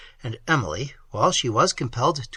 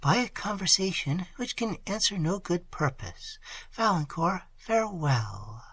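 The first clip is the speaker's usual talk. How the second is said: put-on higher, soft young woman's voice; Emily's farewell; 4 semitones higher, 6 dB quieter than usual. A narrator's reading. A young woman ending the meeting, soft and high. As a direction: keep it hushed and sad. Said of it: "said she".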